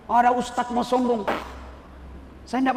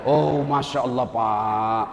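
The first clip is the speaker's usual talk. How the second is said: ordinary talk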